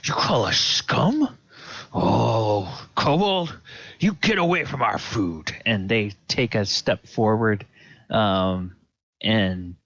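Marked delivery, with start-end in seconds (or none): 0.0-5.6 higher, indignant cultist voice; offended cultist. Acted. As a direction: warn the kobold off as an affronted cultist. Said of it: "And the cultists are like".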